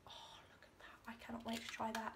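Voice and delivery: hushed excited whisper. Real, not acted. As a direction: breathe it out in awe. Whispering over a gift.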